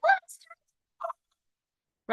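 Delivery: high, stretched-out gleeful mock squeal; very high drawn-out cheer; mock excited squeal. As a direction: squeal it out like the excited part of your brain. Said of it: "So you're like".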